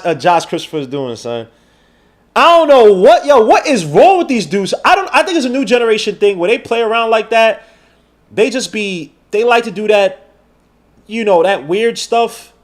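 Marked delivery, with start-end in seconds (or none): 2.3-10.3 high, loud exasperated disbelief; exasperated rant. Real, not acted. Streamer ranting in disbelief about young players' antics. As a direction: throw your hands up in disbelief.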